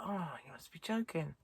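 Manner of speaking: breathless whisper of disbelief. Retold or imitated. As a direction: hush it like a private thought. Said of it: "I thought".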